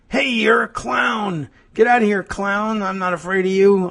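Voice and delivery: high-pitched acted taunt, no real volume; mock yelling. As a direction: imitate a yelled challenge, drawing out the opening. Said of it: "come out and yelled at me".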